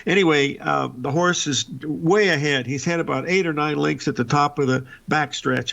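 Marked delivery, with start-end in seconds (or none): none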